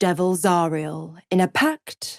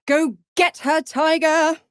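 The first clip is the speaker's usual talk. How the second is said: high-pitched, egging-on cheer; mock cheering; 9 semitones higher, 4 dB louder than usual. Devil egging on her tiger, mock cheering. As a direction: cheer them on, pitched well up.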